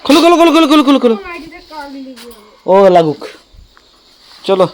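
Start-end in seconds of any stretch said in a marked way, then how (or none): 0.1-1.1 high, loud rapid-fire repetition; urgent urging. Real, not acted. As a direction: urge them on, loud and high. Urging someone to open it. (তাড়া দিয়ে চড়া গলায়)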